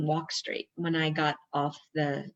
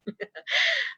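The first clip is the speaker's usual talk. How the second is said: high laugh. Real, not acted. Laughs at student's reply.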